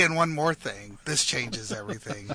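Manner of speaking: soft, low keynote reveal; hushed dramatic product-launch line; Steve Jobs keynote voice. Retold or imitated. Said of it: "You didn't say".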